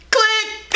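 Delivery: high-pitched mouth click. Retold or imitated. Hanging up on the ex, squeaky click sound. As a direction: pop it up high like a phone hanging up. Imitating hang-up.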